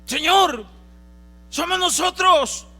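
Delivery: loud, high pleading cry; desperate plea. Acted. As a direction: beg the Lord at full voice, high and loud. Hijos suplicando al Señor. (súplica desesperada a gritos)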